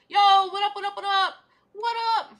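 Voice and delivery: high, loud hype-up call; hyped greeting. Pretend.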